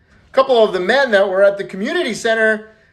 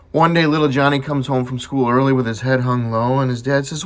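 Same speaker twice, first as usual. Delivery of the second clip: ordinary talk, nothing marked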